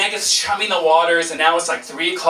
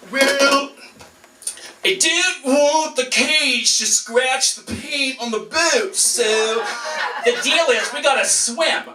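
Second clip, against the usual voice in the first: higher, slow, dim captain voice; dopey captain; 6 semitones higher, slower than usual. Retold or imitated. Captain explaining the swim to the tourists, slow and higher. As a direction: drawl like a clueless boat captain.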